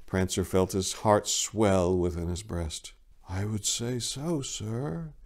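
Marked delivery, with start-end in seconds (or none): 3.3-5.1 hushed, awed whisper to Santa. Acted. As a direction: barely get the words out, softly. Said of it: "he managed to whisper to Santa".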